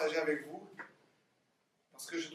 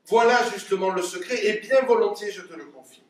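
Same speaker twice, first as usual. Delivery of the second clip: loud, higher theatrical announcement; eager revelation; 4 semitones higher, 7 dB louder than usual. Acted. Father confides the secret of acting to daughter, eagerly. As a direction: proclaim the secret with relish.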